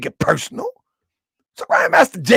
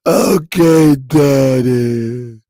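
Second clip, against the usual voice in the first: mock daughter giving in; 6 semitones lower, 6 dB louder, much slower than usual. Daughter gives in.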